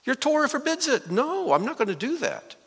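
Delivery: high-pitched acted protest; protesting refusal. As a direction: push the voice way up in objection. Voicing Peter's refusal to God.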